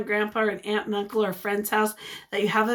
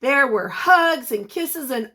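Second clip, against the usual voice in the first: usual speaking voice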